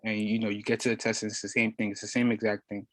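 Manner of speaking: ordinary talk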